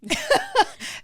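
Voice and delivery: high, teary laugh; a high, emotional laugh; burst of laughter. Real, not acted. Moved to tears by the article.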